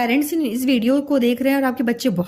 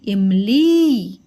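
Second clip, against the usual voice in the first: slow drawn-out teaching; 3 semitones lower, 4 dB louder, much slower than usual. Shown as a demonstration. Teacher drawing out word for kids.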